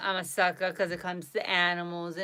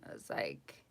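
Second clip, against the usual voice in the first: whisper; 12 dB quieter than usual. Real, not acted. Whispered aside in story.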